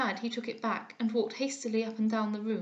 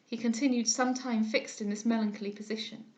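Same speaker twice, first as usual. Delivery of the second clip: plain reading voice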